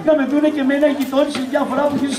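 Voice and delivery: shouty nagging. Acted. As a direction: reel off grievances without a breath. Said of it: "Μη φωνάζεις". (γρήγορη, φωναχτή γκρίνια)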